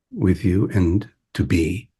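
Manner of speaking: ordinary delivery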